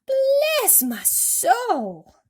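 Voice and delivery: very slow, high, loud astonishment; cried out. A narrator's reading. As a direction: gasp it out in disbelief. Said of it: "cried the head of the fire department".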